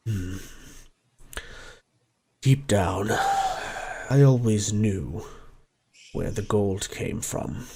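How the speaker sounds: put-on an old dragon admitting his greed; remorseful gold dragon. The dragon Arnax confesses to the party, low and soft.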